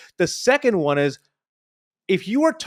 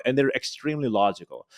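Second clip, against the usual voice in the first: normal talking voice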